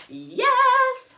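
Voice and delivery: high, loud cheer of joy; thrilled high cheer; excited yell. Real, not acted. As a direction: squeal it out. Big reveal of new machine.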